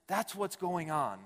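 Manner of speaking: usual speaking voice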